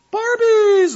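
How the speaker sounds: very high, loud squeal; little girl shrieking for Barbies; child squealing. Retold or imitated. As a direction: squeal the word like an excited kid. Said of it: "her voice kept getting higher in pitch".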